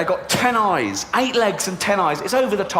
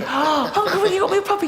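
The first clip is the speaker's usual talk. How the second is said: put-on very high squeaky child voice; excited little girl. Niece running to her uncle, high squeal.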